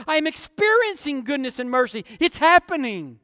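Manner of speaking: ordinary talk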